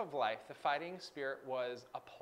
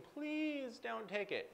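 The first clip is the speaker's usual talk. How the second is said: high, slow pleading plea; 9 semitones higher, 3 dB quieter, slower than usual. Retold or imitated. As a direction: beg softly, high and slow. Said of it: "One was pleading".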